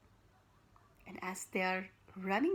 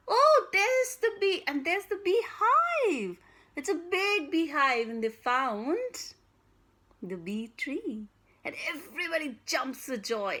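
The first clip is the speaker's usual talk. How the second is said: much higher and louder, thrilled; joyful discovery; 4 semitones higher, 7 dB louder than usual. Acted. Storyteller celebrating finding the bee tree, loud and thrilled. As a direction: burst out with joy at the find.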